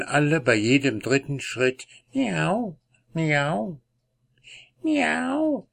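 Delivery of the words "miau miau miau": high drawn-out meows; cat meowing. Acted. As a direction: meow like a cat. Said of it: "sagte der alte Tom wieder".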